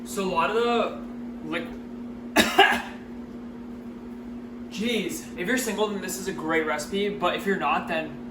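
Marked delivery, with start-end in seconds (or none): 1.7-4.6 coughing fit. Real, not acted. Vinegar fumes in the eyes.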